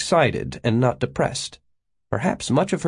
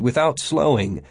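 plain reading voice

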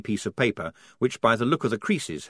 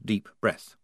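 plain reading voice

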